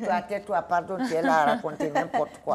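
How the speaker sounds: put-on loud, brusque hush to a child; scolding her son. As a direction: cut him off, exasperated. Mother hushes her five-year-old.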